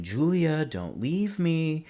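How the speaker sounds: high, drawn-out mock scream; imitated scream. Reviewer mimicking Anise begging Julia to stay, screaming. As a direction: wail it out like the screaming character. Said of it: "Anise start screaming".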